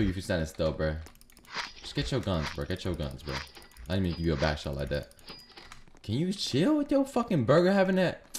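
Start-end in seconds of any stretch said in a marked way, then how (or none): none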